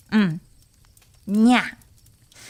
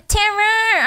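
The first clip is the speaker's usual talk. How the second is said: dramatic shriek; 11 semitones higher, 4 dB louder than usual. Real, not acted. Shocked outcry.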